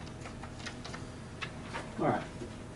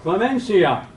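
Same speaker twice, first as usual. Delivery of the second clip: clemencia said higher and louder, lingering on it; key word stressed; 7 semitones higher, 8 dB louder than usual. Shown as a demonstration. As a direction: land hard on clemencia as the key Latin term. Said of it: "our magic word comes up again".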